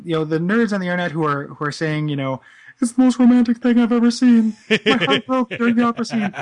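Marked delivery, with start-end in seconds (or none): 2.8-6.3 high, loud mock nerd gush. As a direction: gush like a lovesick internet nerd. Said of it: "Why is your nerd voice a transformer?".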